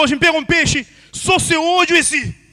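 high, loud bragging punchline; hammed-up high boast; exaggerated fish boast. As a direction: belt it out, stretching the last words slow. Liar's punchline about fish's eye.

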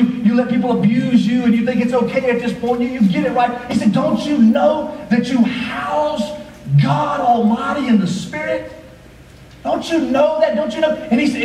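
high, driving preacher cadence; full-force preaching. Acted. Pastor urging the church about the body, preaching at full force. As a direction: pastor pressing the congregation, preaching hard.

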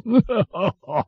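high, hearty royal laugh; king's joking laugh. A narrator's reading. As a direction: laugh at your own joke. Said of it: "his laugh was chorused".

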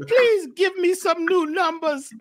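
put-on high-pitched, joking Jamafrican accent; fake Jamafrican accent. Caller jokingly begging King Simon for numbers, fake Jamafrican accent. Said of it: "fake Jamafrican accent".

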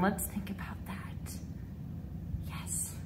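mock thinking in a whisper. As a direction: whisper it to yourself while pondering. Thinking aloud to herself.

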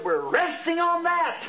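high, slow, drawn-out cry; retold crowd scream. Millions answering the preacher at once, imitated high outcry. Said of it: "millions screamed out at once".

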